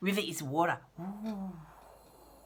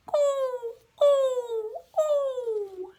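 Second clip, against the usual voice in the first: high hooting ooh; owl hoot imitation; 13 semitones higher, 4 dB louder, much slower than usual. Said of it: "he goes".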